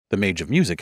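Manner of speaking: ordinary delivery, nothing marked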